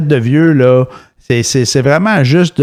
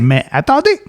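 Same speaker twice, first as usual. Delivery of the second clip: higher, hyped pitchman hook; infomercial; 6 semitones higher than usual. Pretend. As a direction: hype it like a TV ad. (annonceur de télé-achat survolté)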